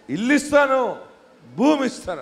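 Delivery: preacher booming God's promise; triumphant proclamation. Retold or imitated. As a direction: proclaim it to the whole church.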